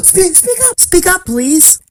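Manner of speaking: Horton. A narrator's reading. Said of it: "said Horton".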